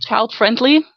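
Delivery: ordinary delivery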